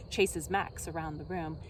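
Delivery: ordinary delivery, nothing marked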